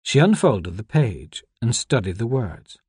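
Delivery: usual speaking voice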